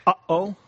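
"uh-oh" with a catch between the syllables; "uh-oh" split by a tiny stopped pause; glottal stop. Shown as a demonstration. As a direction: break "uh-oh" in the throat. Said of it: "That, that stop".

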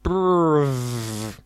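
long rolled brrr, pitch gliding down; drawn-out motor brrr; car engine noise. Acted. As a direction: imitate a car engine with your lips. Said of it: "said the car".

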